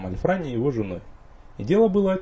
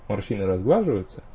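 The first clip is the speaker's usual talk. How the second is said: usual speaking voice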